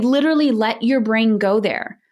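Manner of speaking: ordinary delivery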